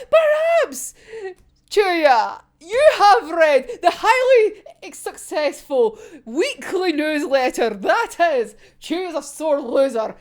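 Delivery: grandly drawn-out mock sales pitch; theatrical taunt. Voicing Dazai pitching his newsletter to Chuya, theatrical smugness.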